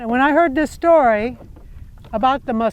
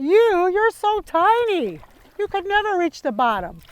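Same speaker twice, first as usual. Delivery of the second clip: mocking animals; 7 semitones higher than usual. Animals laughing at the tiny muskrat, mild mocking. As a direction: tease the little muskrat, lightly voiced. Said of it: "They thought".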